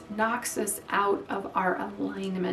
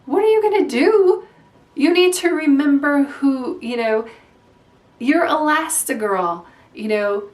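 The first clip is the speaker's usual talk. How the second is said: loud, higher, lightly voiced Edna scolding; bossy Edna; 5 semitones higher, 8 dB louder than usual. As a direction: snap her back to herself, higher and louder. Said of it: "And she says".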